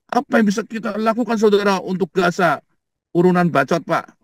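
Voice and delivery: high-pitched parody of official concern; mock an empty political appeal; mock government official. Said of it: "kayak pemerintah Indonesia".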